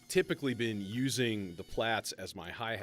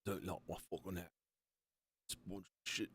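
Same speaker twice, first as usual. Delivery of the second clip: put-on failed Scottish accent; 7 dB quieter than usual. Streamer joking about Scots, failed Scottish accent. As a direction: attempt a bad Scottish accent, kept quiet. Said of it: "That wasn't a Scottish accent".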